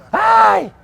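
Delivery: high mock yelp of fright; mock panicked cry. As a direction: yelp like a scared apostle in the storm. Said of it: "ellos estaban pegando gritos".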